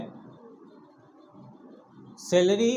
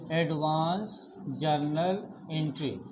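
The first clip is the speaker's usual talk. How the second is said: slow, lower and softer, each word laid out; slow title announcement; 4 semitones lower, 5 dB quieter than usual. Shown as a demonstration. Teacher announcing the chapter title to students, slow and low. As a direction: announce the chapter name deliberately. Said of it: "जिसका नाम है".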